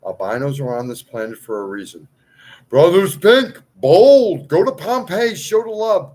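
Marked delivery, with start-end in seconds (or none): 2.7-6.1 loud, high and fired up, calling out; rallying shout. Real, not acted. Preacher rallying his followers with a loud, high shout. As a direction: shout it like a rallying cry to a crowd.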